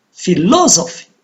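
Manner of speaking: "Philosophy" with LO stressed, then falling; phi-LO-so-phy, peak on LO in "Philosophy"; stress on second syllable. Shown as a demonstration. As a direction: punch the LO in "Philosophy". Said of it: "it is on the second".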